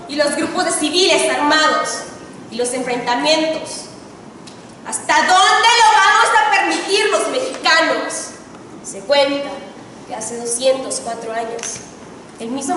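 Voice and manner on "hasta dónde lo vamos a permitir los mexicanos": impassioned challenge. Real, not acted. Orator challenges the audience.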